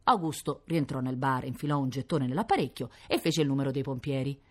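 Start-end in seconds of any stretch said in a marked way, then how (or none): none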